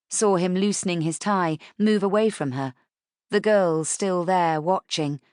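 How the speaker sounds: ordinary delivery, nothing marked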